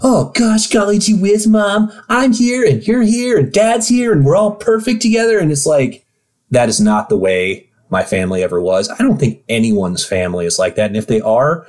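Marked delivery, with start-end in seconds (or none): 0.0-5.4 high, gushing golly-gee voice; wholesome 1950s kid. Retold or imitated. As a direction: gush like a sugary sitcom kid. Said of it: "all these Christmas films are always like".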